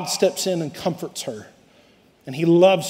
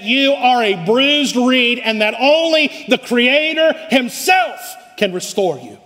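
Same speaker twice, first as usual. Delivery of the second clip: forceful preaching; 6 semitones higher than usual. Real, not acted.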